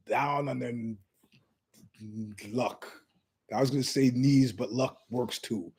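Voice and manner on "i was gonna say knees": ordinary delivery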